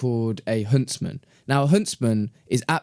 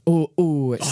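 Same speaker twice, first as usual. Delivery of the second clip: a light Scottish accent, imitating a nervous friend. Mocking friend's fear in lift, light Scottish accent. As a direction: mimic his wee Scottish accent, half-joking. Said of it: "in his little Scottish accent".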